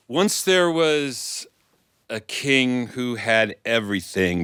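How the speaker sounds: slow, lifted story opening; storytelling opening. Acted. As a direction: draw out the first words to pull them in. Storyteller opens the tale.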